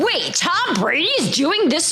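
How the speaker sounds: slow, astonished retold question; incredulous. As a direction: imitate friends who can't believe it. Acting out friends' astonished reaction.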